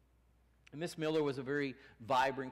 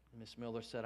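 ordinary talk

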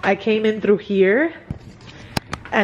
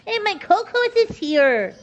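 very high cooing sing-song; gushing affection; 10 semitones higher than usual. Real, not acted. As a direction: coo it in delight, pitched way up. Cooing over Coco being here.

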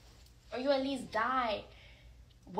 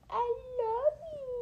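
squeaky high, slower coaxing love you; high baby-talk voice; 9 semitones higher, 5 dB quieter, slower than usual. Shown as a demonstration. Urging lazy Stitch to answer, slow squeaky high coo. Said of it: "Don't be lazy, Stitch".

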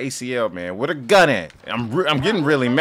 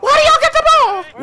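high, loud outraged shriek; indignant squeal; 7 dB louder than usual. Real, not acted. Gamer complaining the other team has the ball, high loud shriek. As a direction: protest at the top of your voice.

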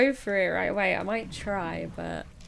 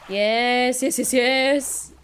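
loud, slow, drawn-out yeses; excited yeses; 6 dB louder, slower than usual. Real, not acted. Player delighted with a very nice find, excited yeses. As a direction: relish every yes, loudly.

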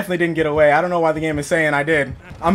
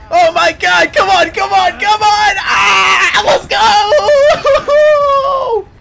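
rising into high, loud frantic shrieks; panicked screaming; 14 semitones higher, 4 dB louder, much slower than usual. Real, not acted. Player screaming while chased by the killer, frantic shrieks. Being chased, near dying.

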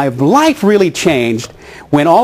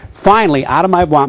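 usual speaking voice

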